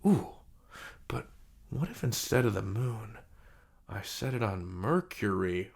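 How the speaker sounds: usual speaking voice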